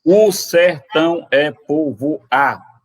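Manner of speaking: slow, syllable by syllable; scanning the verse. Shown as a demonstration. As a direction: tap out the metre. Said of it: "Sete sílabas". (escandindo "o sertão é povoado" devagar)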